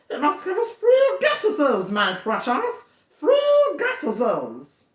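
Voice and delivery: high, hissing creature voice; wheedling Gollum, pitched high. Acted. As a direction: croon it as a slimy cave creature talking to itself. Gollum bargaining.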